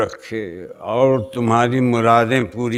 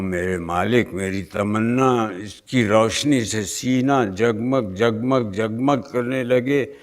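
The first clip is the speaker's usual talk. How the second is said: soft, low-pitched plea; humble petition; 5 semitones lower, 6 dB quieter than usual. Acted. Worshipper tells his Master of his longing, humbly hushed. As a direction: lower the voice in deference to the Almighty.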